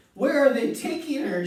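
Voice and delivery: high, loud frantic question; shrieking. A narrator's reading. Missus Salt shrieking at Wonka, high and loud. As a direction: demand answers in a panic. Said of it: "shrieked Missus Salt".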